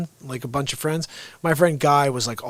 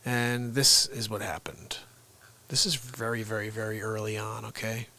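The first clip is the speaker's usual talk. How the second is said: whisper; 5 semitones lower, 9 dB quieter than usual. Real, not acted. Whisper teaser.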